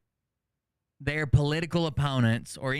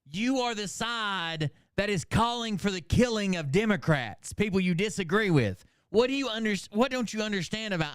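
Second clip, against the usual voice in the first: raised, pitched-up exasperation; indignant rant; 7 semitones higher than usual. Real, not acted. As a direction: call them out, voice climbing. Angry rant at commenters.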